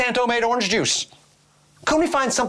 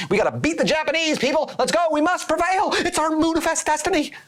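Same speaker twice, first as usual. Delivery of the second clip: rallying cry; 8 semitones higher than usual. Acted. Mock patriotic rallying cry.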